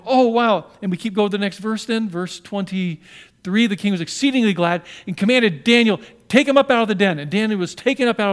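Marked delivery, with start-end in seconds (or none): 0.0-0.7 higher, louder gasp of wonder; amazed. Retold or imitated. Stunned king seeing Daniel unharmed, amazed. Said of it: "And he's going". 6.3-7.2 louder, higher royal order; command. Acted. Glad king commanding his servants, loud order.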